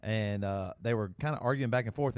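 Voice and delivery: normal talking voice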